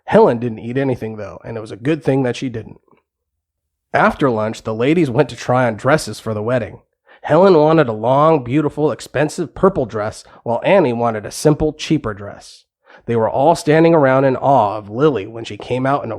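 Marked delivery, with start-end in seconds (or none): none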